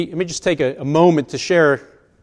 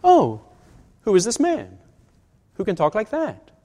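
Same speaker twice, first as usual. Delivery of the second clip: higher-pitched, wide-eyed curious question; marveling, sincere curiosity; innocent wonder; 5 semitones higher than usual. Shown as a demonstration. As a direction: ask it in genuine awe, unhurried and broken up. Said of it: "they didn't mean".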